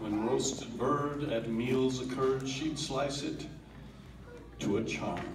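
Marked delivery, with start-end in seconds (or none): none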